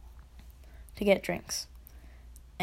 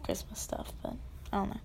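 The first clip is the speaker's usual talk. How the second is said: whispering; 12 dB quieter, faster than usual. Real, not acted. Trailing aside about colours.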